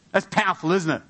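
ordinary talk, nothing marked

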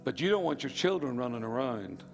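normal talking voice

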